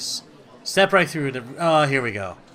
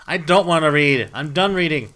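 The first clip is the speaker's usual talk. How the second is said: loud, higher fed-up protest; frustrated shout; 4 semitones higher, 12 dB louder than usual. Real, not acted. Gamer fed up with reading, loud protest. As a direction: shout it like you're done with it.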